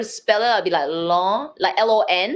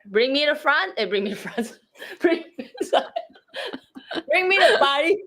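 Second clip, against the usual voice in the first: laughing mispronunciation; 9 semitones higher than usual. Real, not acted. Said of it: "even the word Paris is wrong".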